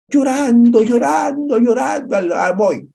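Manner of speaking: high shouted wail, drawn out; preacher shouting Joshua's weeping, high and loud; wailing cry of grief. Acted. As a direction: cry it out like a man in mourning.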